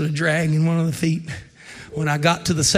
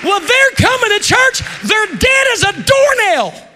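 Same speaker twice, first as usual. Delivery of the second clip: high, shouted preacher's cry; full-force preaching; 8 dB louder, slower than usual. Real, not acted. Preacher shouting at the congregation, full force. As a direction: hammer the point home to the church.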